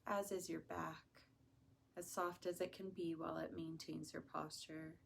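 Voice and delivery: soft, unhurried meditation voice; hushed, soothing guidance with long pauses; guided meditation. Acted. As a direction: ease them gently into relaxation, softly.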